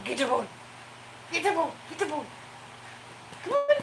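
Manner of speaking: high, delighted cheering for the running dog; excited pet talk. Real, not acted. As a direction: cheer the pup on, high and bright. Cheering dog doing laps.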